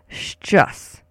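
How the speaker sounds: "Szczęs" as one syllable, shchus; syllable demo. Shown as a demonstration. Teacher leads repeat-after-me, "Szczęs" isolated as shchus. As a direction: model "Szczęs" crisply for repeating, shch plus us. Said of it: "So say that with me".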